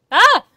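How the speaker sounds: high, loud startled scream; shocked shriek. Real, not acted. Gamer seeing the ugly outfit, shocked shriek. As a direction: shriek at the sight.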